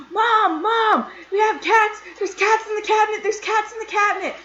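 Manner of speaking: high-pitched, loud frantic shout; acted frantic yelling. Kid runs inside to tell Mom about cats, re-enacted yelling. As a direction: yell for help, re-enacting total panic. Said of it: "yelling, screaming".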